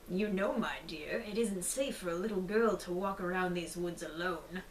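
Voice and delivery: sly wolf. Acted. Wolf talks to the girl.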